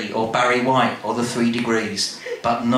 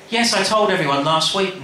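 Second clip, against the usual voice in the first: Nan's voice; 6 semitones higher than usual. Acted. Nan's voice in read story.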